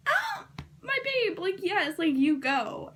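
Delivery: high, gushing squeal easing into loud approval; hammed-up cheer for a favorite heroine; retold cheering. Retold cheering reaction to the killing.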